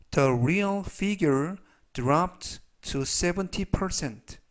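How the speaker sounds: very slow, measured English read-aloud; slow model reading. Shown as a demonstration. Teacher reads example sentences for learners to review, slowly. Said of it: "천천히 세 번씩 읽어드리고요".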